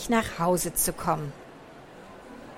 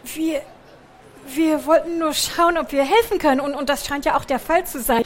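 haspelnd; 4 semitones higher than usual. Acted. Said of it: "haspelte er".

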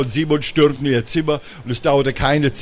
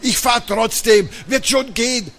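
high-pitched, mildly pained insistence; groaning; 10 semitones higher than usual. A narrator's reading. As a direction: groan it out from the sofa. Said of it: "Papa lag auf dem Sofa und stöhnte".

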